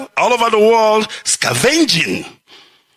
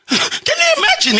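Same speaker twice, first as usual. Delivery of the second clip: incredulous laugh. Real, not acted. Laughing disbelief at his plight.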